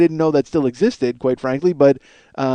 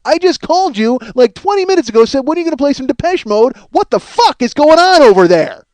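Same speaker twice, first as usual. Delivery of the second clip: raised, ranting caller, pitched up; loud mock rant; 8 semitones higher, 4 dB louder than usual. Caller yelling at the radio DJ, hammed-up loud. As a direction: bark it at the DJ like he owes you. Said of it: "it's fucking Cody".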